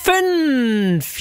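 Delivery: drawn-out fünf. Real, not acted. Announces the rolled number.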